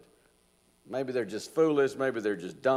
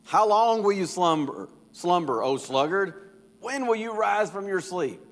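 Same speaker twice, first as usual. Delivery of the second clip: higher and louder, an exasperated wise man scolding; impatient; 8 semitones higher, 4 dB louder than usual. Acted. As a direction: push him, you're fed up waiting. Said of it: "he sounds almost impatient".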